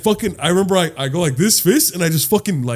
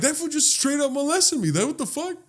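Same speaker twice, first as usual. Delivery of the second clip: high, softer incredulous complaint; retold incredulous protest; 12 semitones higher, 4 dB quieter than usual. Drunk man telling friends of the grope, high protest. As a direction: plead your outrage in a high voice.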